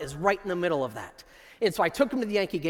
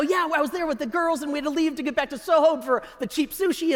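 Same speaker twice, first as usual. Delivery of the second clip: cheerful boasting; 7 semitones higher than usual. Retold or imitated. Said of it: "And I go".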